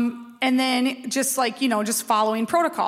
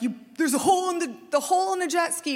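retold angry panic; 6 semitones higher, faster than usual. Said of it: "panic and anger".

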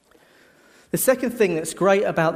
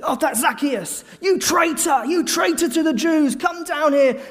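mocking crowd hurling abuse from below the tree; 12 semitones higher than usual. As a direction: shout him down like an angry crowd. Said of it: "hurling abuse at him".

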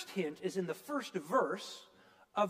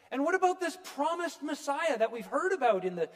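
ordinary talk, nothing marked

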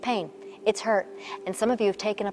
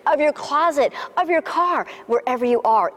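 each room pitched higher and louder; preacher building to a peak; building preaching; 4 semitones higher, 4 dB louder than usual. Acted. Pastor to women watching, preaching higher and louder.

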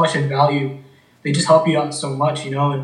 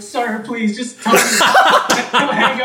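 high, laughing mock plea to a customer; 8 semitones higher, 4 dB louder than usual. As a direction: beg through laughter, pitched up. Pleading with hung-up customer, laughing.

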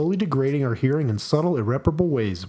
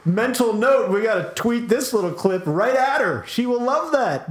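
excited; 7 semitones higher than usual. Real, not acted. Excited plan to tweet clip.

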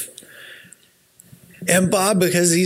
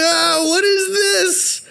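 high, drawn-out gasp of shock, much higher; much slower than usual. Retold or imitated. Bob spotting the dented painting, high drawn-out shock. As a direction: stretch it out, appalled at the damage. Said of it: "And he's like".

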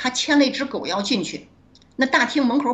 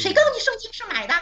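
annoyed classmate; 13 semitones higher, faster than usual. Retold or imitated. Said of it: "他就生气了".

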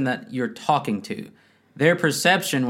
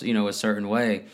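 ordinary talk

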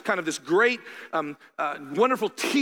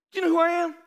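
mock indignant; 11 semitones higher, 4 dB louder, much faster than usual. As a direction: Do you know who I AM, pitched much higher and louder. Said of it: "I'm not like".

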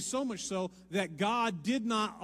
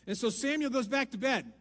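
much louder and higher, pressing on; full-force preaching; 9 semitones higher, 9 dB louder than usual. Acted. Preacher acting out Eli and Samuel at full force. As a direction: preach it out loud to the room.

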